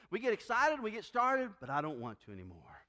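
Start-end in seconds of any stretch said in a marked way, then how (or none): 1.7-2.8 low, soft, mock-reluctant; reluctant excuse. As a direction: voice a believer losing interest, low and quiet. Acted excuse of flagging churchgoer.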